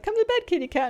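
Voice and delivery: very high sing-song coo; cooing to sleepy cat. Retold or imitated. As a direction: coo her off to bed in a tiny voice. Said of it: "when she's really sleepy".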